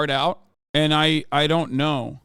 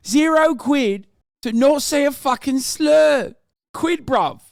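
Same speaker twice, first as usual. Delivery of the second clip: hammed-up London accent, higher and louder, slow; mock British accent; 9 semitones higher, 5 dB louder, slower than usual. Streamer mocking British slang back at chat in a hammed-up British accent.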